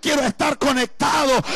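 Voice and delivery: loud, high cry of "Quiero estar conectado"; fervent shouted prayer line; shouted plea. Acted. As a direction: belt the plea out to the Father. Pastor voicing prayer, shouted.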